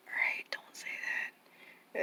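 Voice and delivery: hushed, breathy warning; whispered warning. Retold or imitated. Aunt quietly warning her nephew not to say it. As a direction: drop to a whisper, firm.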